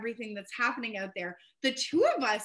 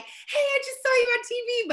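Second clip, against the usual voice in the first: high, loud gush of recognition; excited greeting; 13 semitones higher, 7 dB louder than usual. Retold or imitated. Viewers reaching out after spotting her on TV, excited. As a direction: imitate thrilled viewers, pitched way up and laughing.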